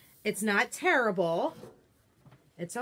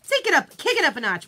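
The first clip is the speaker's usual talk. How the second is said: high loud take it up; enthusiastic shout; 5 semitones higher, 6 dB louder, faster than usual. Real, not acted. Host echoing friend's catchphrase, loudly excited. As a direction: belt it out with gusto.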